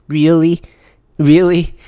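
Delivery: stunned sarcastic disbelief; incredulous. Real, not acted. Reacting to read statement.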